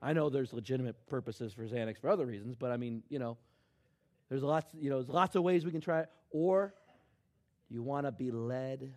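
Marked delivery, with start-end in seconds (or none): none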